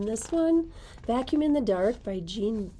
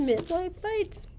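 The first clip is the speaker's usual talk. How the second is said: slow, much higher sing-song echo of the title; playful echo; 10 semitones higher, slower than usual. Acted. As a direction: stretch it out high and teasing. Cohost echoes title playfully.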